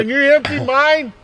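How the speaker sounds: very high squeaky mock plea; squeaky put-on voice. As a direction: ask it in a squeaky cartoon voice.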